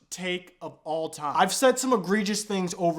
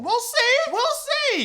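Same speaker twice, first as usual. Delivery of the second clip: much higher, louder teasing "We'll see"; sarcastic sing-song; 5 dB louder than usual. Real, not acted. Doubting the rookie to viewers, sarcastic and high. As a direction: tease it up high like you doubt it.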